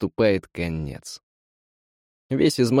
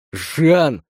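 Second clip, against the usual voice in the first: yelled name; 5 semitones higher, 3 dB louder than usual. Acted. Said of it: "завопил его брат".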